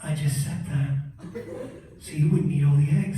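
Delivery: low-pitched deadpan mother; low voice of an unbothered mom; matter-of-fact mom. Acted. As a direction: confess it low, without remorse. Mom's deadpan confession acted.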